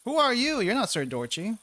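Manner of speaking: startled question. Acted. NPC suddenly appears, read aloud.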